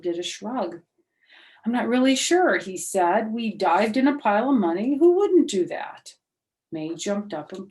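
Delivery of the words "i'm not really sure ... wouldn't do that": high, loud, rising to a squeaky who-wouldn't; a boy baffled that anyone would refuse; excited kid. Acted. Herb defends diving in money.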